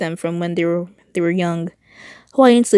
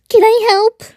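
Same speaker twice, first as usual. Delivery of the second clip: high character voice; 7 dB louder than usual. Acted. Character line, high put-on voice.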